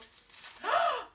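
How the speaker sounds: thrilled gasp at a gift; loud high gasp. Real, not acted. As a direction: gasp loudly in delight. Opening a gift, big surprise.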